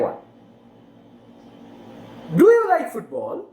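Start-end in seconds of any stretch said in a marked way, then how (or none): 2.4-3.5 "Do you like football" high and rising; rising question intonation. Shown as a demonstration. Teacher's second yes or no example, rising intonation. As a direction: lift the pitch through to FOOTBALL. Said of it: "questions where you can use rising intonation".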